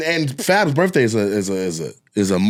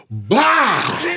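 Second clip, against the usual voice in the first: explosion noise; 10 semitones higher than usual. Retold or imitated. Stool explodes under him.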